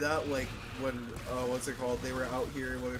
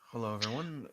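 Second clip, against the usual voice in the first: boring YouTuber intro; 2 semitones higher, 4 dB quieter than usual. Retold or imitated. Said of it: "he's become so boring".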